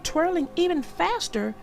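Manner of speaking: plain reading voice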